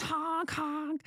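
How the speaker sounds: high honking goose voice. Retold or imitated. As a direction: honk it like a goose. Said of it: "every other word he said was".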